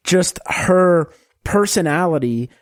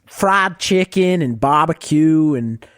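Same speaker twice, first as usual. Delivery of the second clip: put-on Southern US accent; slower than usual. Shown as a demonstration. Podcaster doing requested impression for co-hosts, broad Southern accent. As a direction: lay on a broad Southern drawl. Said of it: "the Southern accent?".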